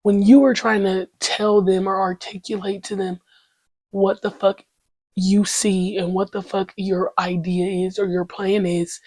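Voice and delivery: ordinary delivery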